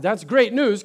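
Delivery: normal talking voice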